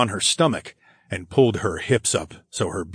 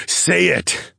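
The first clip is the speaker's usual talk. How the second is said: firm command, pitched up; raised-pitch, insistent command; ordering; 9 semitones higher, 3 dB louder than usual. A narrator's reading. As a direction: give it as a short order. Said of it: "I ordered".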